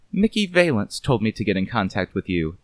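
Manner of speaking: a hint of a cool, measured lady; precise, unhurried woman. A narrator's reading. Woman coolly putting down the detective, precise.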